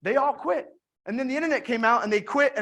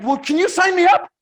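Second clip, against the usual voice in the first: louder and higher, keen to sign up; eager; 6 semitones higher, 9 dB louder than usual. Retold or imitated. Joel begging to join, eager and loud. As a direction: jump at the offer.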